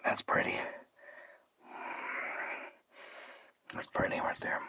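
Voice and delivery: soft admiring whisper. Real, not acted. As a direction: whisper it softly.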